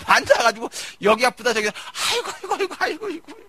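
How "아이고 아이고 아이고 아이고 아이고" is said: mock groaning. Mimicking sick people complaining.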